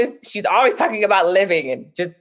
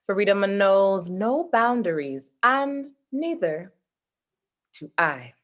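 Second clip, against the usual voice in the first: slow, softer recited line with long pauses; poem recital; 4 dB quieter than usual. Shown as a demonstration. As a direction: let the closing line land slowly. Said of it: "I'll close it with a poem stanza".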